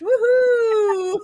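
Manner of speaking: high whooping cheer; excited cheer. Real, not acted. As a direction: whoop it up for the sale. Cheering the winning bid.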